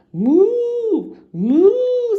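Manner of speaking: high, loud mooing; cow moo. A narrator's reading. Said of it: "says the cow".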